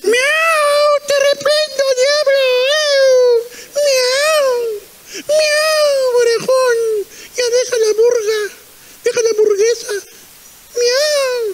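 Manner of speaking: very high meowing cat voice; talking cat. Pretend. God speaks through a cat to rebuke listener, squeaky cat voice. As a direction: scold like a cat, stretching the meows. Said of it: "no te asustes si usa el gato".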